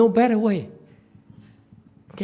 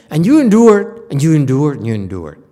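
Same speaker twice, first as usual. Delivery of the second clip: loud insistence fading to a low murmur; fading repetition; 4 semitones lower, 5 dB louder than usual. Real, not acted. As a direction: let each repeat drop away until it is nearly gone. Repeating the lesson, dying away.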